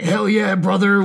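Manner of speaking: loud, high hyped-up bro. Retold or imitated. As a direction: yell it like a pumped biker dude. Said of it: "it's just people being like".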